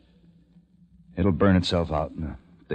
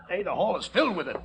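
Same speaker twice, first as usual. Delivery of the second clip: higher and quicker in alarm; urgent alarm; 13 semitones higher, 4 dB quieter than usual. Acted. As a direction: rush the warning out. Discovers gas in hall.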